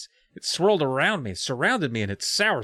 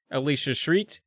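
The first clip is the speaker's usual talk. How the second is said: ordinary delivery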